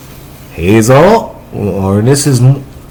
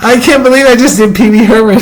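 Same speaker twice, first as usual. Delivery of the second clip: high, fast, giggly disbelief; laughing; 10 semitones higher, much faster than usual. Real, not acted. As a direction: blurt it out through laughter. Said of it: "laughing at own impression".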